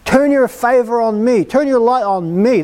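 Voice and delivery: insistent mock cry for mercy. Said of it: "You gotta kick him in the shins".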